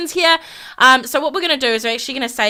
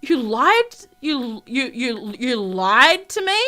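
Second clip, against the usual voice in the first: halting, stammered disbelief; acted hurt and incredulous; stunned disbelief; much slower than usual. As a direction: falter on the words, then draw out the question. Acted shock at the confession.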